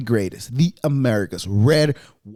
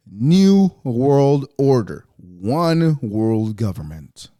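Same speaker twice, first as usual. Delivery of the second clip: slow emphatic; 5 semitones lower, much slower than usual. Acted.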